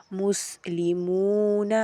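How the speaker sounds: مسلمون with the long oo held a full beat; long vowel drawn out. Shown as a demonstration. Teacher drawing out the long vowel for pupils. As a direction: stretch the oo in مسلمون slowly. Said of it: "حروف مدہ کو کھینچ کر پڑھوں گی".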